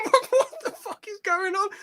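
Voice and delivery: high, squeaky what-the-hell disbelief; baffled disbelief. Retold or imitated. Gamer reacting to the odd voice switch in a high baffled squeak. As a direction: pitch it way up like you can't believe your ears.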